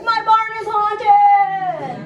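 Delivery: high, loud, slow frightened cry; scared farmer. Acted. Pioneer farmer sees a ghost in his barn, loud slow high wail. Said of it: "the farmer would be like".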